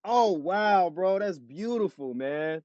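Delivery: high, softened awe; amazed admiration. Real, not acted. Guest hears of a sixteen-year marriage, amazed and touched. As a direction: marvel at it in a lifted, gentle voice.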